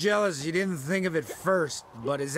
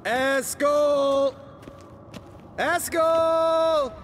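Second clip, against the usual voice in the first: loud call, higher pitch; 9 semitones higher, 4 dB louder, much slower than usual. Acted. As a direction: shout his name across the keep. Calling for missing friend.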